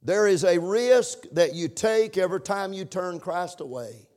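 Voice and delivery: ordinary delivery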